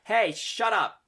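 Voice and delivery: high, loud irritated snap; bristling snap. Acted. Sylvie snapping at the rude upperclassman. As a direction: cut him off sharply. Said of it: "Sylvie kind of bristles".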